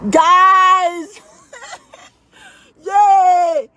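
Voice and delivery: loud, high yell of joy; excited shout. Real, not acted. Streamer cheering to her viewers, excited shout. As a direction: call out to the chat in delight.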